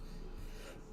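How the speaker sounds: sudden sputtering cough; coughing up water. Real, not acted. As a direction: cough out water that went down wrong. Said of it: "That water went down the wrong way".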